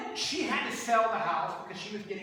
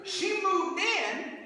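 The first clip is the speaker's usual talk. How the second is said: loud, high sermon drive; rally the church toward amen; full-force preaching to the congregation; 10 semitones higher, 7 dB louder than usual. Acted.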